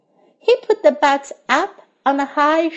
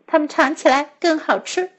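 usual speaking voice

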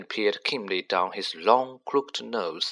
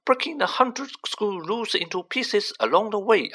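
high, rising to an exasperated cry; stern witch, alarmed and demanding; strict teacher voice; 13 semitones higher, 5 dB louder than usual. Acted. McGonagall demands how he survived.

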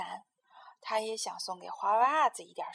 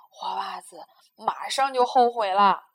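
usual speaking voice

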